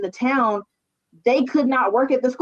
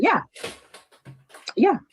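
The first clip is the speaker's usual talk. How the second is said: ordinary talk, nothing marked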